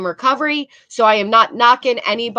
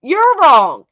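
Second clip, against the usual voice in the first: louder hammed-up accusation; mock yell; 4 semitones higher, 7 dB louder than usual. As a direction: throw it back at him in parody. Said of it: "look at him".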